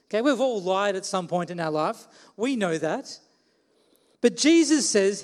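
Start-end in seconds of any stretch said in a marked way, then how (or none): none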